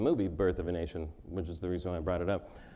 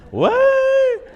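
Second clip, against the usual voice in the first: much higher, loud shocked yelp; mock shock; 14 dB louder than usual. Acting the audience's shock at the joke, a high loud yelp. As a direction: squeal it in fake outrage.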